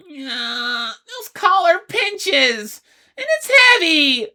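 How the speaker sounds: high, loud whiny creature voice; Grim whining about the heavy collar. Acted. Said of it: "Grim whined".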